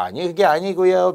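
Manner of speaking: high-pitched, lightly acted backpedaling; making excuses. Servant makes excuses to the nobleman, feigned apology. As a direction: hastily deny it in mock apology. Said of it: "변명".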